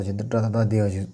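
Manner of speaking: ordinary delivery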